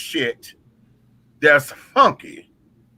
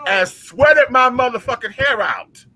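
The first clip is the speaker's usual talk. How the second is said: outraged; 6 semitones higher, 3 dB louder than usual. Real, not acted. Outraged storytelling, shouted.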